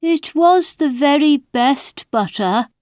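high, plaintive protest; sad. A narrator's reading. March Hare excusing the butter in the watch, sadly. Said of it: "shaking his head sadly".

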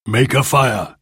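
firm chief's command; ordering chief. A narrator's reading. Golden Eagle commands his men, firm. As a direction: give the order with authority. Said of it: "ordered Golden Eagle".

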